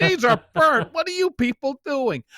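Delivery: exaggerated indignant whine; mock complaint. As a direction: ham up a picky diner's outrage. Imitating a fussy customer complaining.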